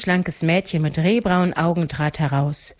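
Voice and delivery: usual speaking voice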